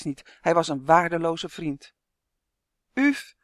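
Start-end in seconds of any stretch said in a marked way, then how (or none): 2.9-3.3 high, loud pleading call. A narrator's reading. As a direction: call after the dragonfly, begging. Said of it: "riep hij". (hoog en luid smekend roepend)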